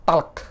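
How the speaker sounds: "Talk" said with the L unvoiced, "tok"; "Talk" with the L left silent. Shown as a demonstration. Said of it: "L-nya tak dibaca".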